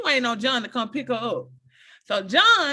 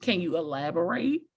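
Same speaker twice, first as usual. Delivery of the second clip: dry quiet ask; 6 semitones lower, 6 dB quieter than usual. Retold or imitated. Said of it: "I was like".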